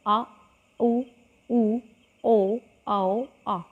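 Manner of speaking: आ उ ऊ ओ औ each clearly separated; vowel demonstration. Shown as a demonstration. Teacher repeats back vowels with the class, clearly sounded. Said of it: "एक बार और बोल के देखेंगे हम".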